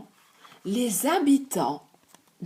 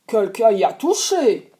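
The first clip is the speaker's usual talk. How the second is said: big bear voice; 3 semitones lower, 6 dB louder than usual. Acted. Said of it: "le grand ours ... dit".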